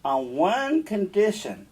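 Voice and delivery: witch voice. Acted. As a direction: offer the deal like a sly witch. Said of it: "Then the witch said".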